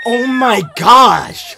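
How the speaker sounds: long, drawn-out shocked yell; excited shout. Real, not acted. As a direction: shout it out in disbelief. Streamer shocked by the arrest clip.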